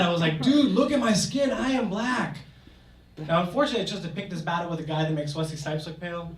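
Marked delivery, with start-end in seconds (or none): 0.4-2.4 higher, louder indignant protest; mad. Retold or imitated. Student snapping back at the stranger, indignant and higher. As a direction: bristle and insist, offended. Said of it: "I got mad".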